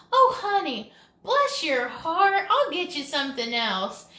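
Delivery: a syrupy Southern lady dripping fake sweetness; sweet Southern lady. Comedian retells a Tennessee waitress's fake-sweet Southern voice. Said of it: "And she said".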